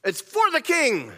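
slow, formal royal messenger call; messenger cry. Shown as a demonstration. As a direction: announce it for the king. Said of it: "you say".

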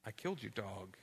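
low, subdued confession after a long pause; worried confession. Retold or imitated. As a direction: break bad news quietly, sounding sick with worry. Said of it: "I was worried and sick".

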